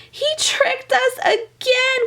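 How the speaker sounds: very high, slow dismayed cry; high stretched cry of dismay. A narrator's reading. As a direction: wail it like a tricked little girl. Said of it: "cried Meg".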